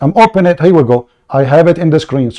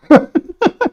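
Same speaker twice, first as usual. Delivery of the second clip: high laugh. Real, not acted. Mocking opponent's reading.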